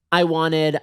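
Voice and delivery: ordinary delivery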